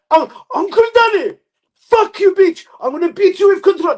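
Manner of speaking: very high, loud screamed threats; mock furious yelling. Relative threatening a beating, mock furious yelling. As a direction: scream it as a hammed-up family row. Acts out furious family quarrel.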